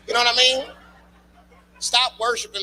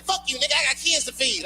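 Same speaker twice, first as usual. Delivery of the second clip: put-on baby talking back; 7 semitones higher than usual. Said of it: "He said".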